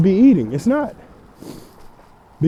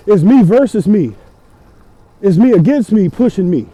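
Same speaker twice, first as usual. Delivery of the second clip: louder, punching each me; fired-up declaration; 4 semitones higher, 6 dB louder than usual. Real, not acted. Cyclist rallying himself against quitting, loud and fierce. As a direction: shout it like a pep talk to yourself. Fired-up self-challenge on the bike.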